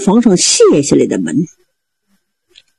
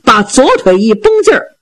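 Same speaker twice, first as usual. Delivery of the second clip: ordinary delivery, nothing marked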